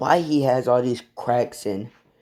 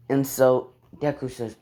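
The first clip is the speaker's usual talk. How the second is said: usual speaking voice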